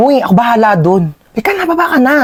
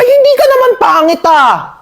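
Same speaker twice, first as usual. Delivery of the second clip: high surprised protest; 10 semitones higher than usual. Acted. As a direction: burst out in protest. Aunt reassuring niece.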